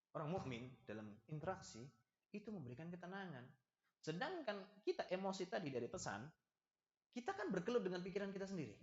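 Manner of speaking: ordinary talk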